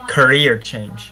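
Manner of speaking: Career change said slowly, loud. Real, not acted.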